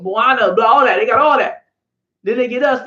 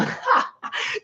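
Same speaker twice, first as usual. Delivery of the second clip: breathless high laugh; 11 semitones higher, 7 dB quieter than usual. Real, not acted. Laughing at Stevie clip.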